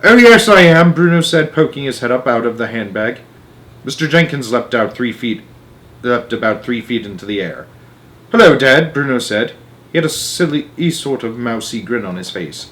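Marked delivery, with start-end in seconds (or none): 0.0-0.9 put-on loud, high cheeky boy voice. Bruno pops up cheekily. 8.3-8.9 put-on bright boy greeting; cheerful boy. Bruno greets father.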